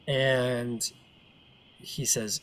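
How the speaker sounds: ordinary delivery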